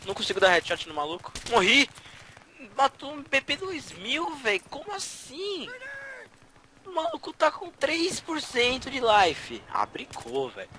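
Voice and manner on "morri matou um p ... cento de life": high, slow incredulous whine; outraged disbelief. Real, not acted. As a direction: stretch it out in disbelief.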